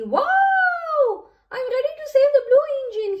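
high, loud, rapid storybook call; excited calling out. A narrator's reading. Eager fire engine races off to a rescue, calling out. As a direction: call out fast like a siren setting off. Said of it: "he called with a honk of his horn".

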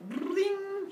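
high ringing pluck; imitated strum. Apollo pleased with his lyre, imitated strum. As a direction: sound it like a struck string.

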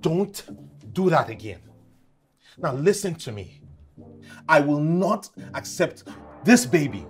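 tense, deliberate anger; stern warning. Acted. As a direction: spell out the refusal slowly, word by word. Warns her after outburst.

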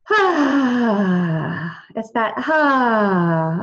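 a long, drawn-out "ah" on the out-breath; slow yawn sigh. Shown as a demonstration. Showing the group a yawn sigh, slow. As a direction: let the sigh stretch out slowly like a yawn. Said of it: "you can kind of do a sigh".

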